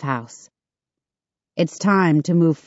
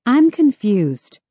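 higher, slow puzzled voice; confused; 6 semitones higher, slower than usual. Acted. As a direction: sound puzzled, drawn out. Sarah puzzled by situation.

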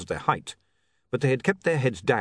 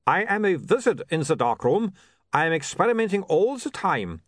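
put-on light Uberwald vampire accent, formal and eager; a keen young vampire from Uberwald, lightly voiced; Otto's Uberwald accent; 8 semitones higher than usual. A narrator's reading. Otto boasts of his skill.

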